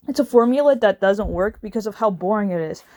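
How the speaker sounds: normal talking voice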